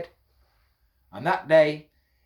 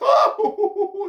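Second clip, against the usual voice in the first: very high, loud hooting laugh; monkey laughter; 7 dB louder than usual. A narrator's reading. Monkeys laughing at the hatter, mocking cackle. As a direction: burst into shrieking monkey hoots. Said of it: "And they laughed and they laughed".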